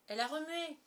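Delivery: high-pitched exclamation; thrilled boy reporting news; excited cry. A narrator's reading. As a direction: announce it in astonished excitement. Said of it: "s'écria un autre".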